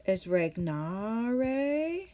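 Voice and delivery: regnare said slowly, each syllable drawn out; slow Latin pronunciation. Shown as a demonstration. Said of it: "the word to rule".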